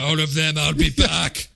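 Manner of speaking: put-on thick jokey Austrian accent; mock Schwarzenegger accent. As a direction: imitate Arnold's Austrian catchphrase. Answering as Arnold in Austrian accent.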